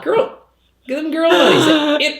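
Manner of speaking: put-on high sassy girl voice; sassy diva voice. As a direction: camp it up, way up high. Playful sassy bit about money.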